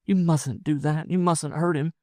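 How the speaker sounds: high woman's voice, imploring; pleading woman. Acted. Wife pleading that her husband not be hurt, imploring.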